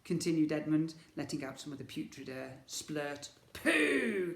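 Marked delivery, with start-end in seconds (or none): 3.5-4.3 disgusted exclaim. Acted. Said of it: "exclaimed Ed-, Ned".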